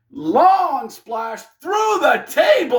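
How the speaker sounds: slow, shouted, high play-by-play; stretched-out shouted spot call; excited call. Real, not acted. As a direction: call it like the crowd just erupted. Calling the big spot.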